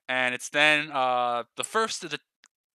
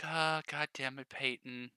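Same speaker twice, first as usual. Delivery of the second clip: softer, resigned sigh of a curse; weary exasperation; 2 semitones lower, 11 dB quieter than usual. Acted. Lily quietly resigned at Peyton's greeting. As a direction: mutter it, worn out by her. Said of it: "Lily's just like".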